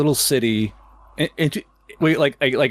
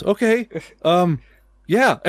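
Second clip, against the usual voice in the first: higher, hesitant clerk voice; lightly voiced awkward clerk; mock clerk; 7 semitones higher, slower than usual. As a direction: clerk offering the bloody hiker a room.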